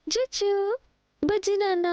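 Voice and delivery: high-pitched cooing; baby talk. Acted. Woman fussing over the baby as her darling, cooing baby talk. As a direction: coo sweetly to a little baby.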